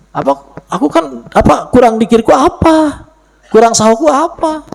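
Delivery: mock the grumbling student; a hint of a petulant whiner; complaining disciple. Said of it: "Bahasa murid yang benar bukan".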